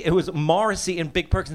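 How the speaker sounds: usual speaking voice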